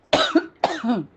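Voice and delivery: a sudden loud, high coughing fit. Real, not acted. Speaker coughs mid-testimony and apologises to the congregation, real coughing fit. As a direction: cough hard, then catch your breath. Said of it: "sorry संगत जी".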